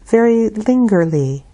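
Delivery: usual speaking voice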